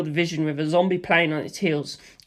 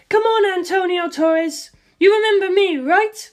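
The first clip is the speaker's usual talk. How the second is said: much higher female voice, slow; higher woman's voice, mocking; teasing woman; 13 semitones higher, 4 dB louder than usual. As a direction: taunt him coolly. Lisa Lisa taunting Antonio.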